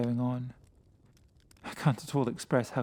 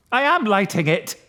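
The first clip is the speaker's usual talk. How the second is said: loud, sharp snapping; savagely; 9 semitones higher, 11 dB louder than usual. Acted. Winston snapping savagely at his frightened friend. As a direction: bite the words off savagely. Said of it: "I said savagely".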